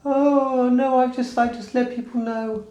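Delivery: a hint of alarm in a forced high voice; mock panic. Imagining slipping at a night out, mild mock panic. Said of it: "You think".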